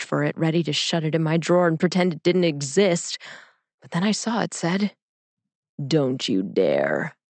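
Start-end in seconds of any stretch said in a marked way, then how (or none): 5.9-7.2 very low, slow threat; slow, deep oracle forbidding it; menacing eight ball. A narrator's reading. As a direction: drop deep and drag the warning out.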